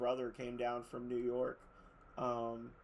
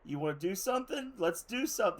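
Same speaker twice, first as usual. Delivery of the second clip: cat voiced as tough guy; 6 semitones higher, 4 dB louder, faster than usual. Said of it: "he just stand there and be like".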